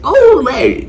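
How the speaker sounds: friends hyped to see him, calling out; excited greeting. Retold or imitated. Friends hyping him up as Larry, high and excited.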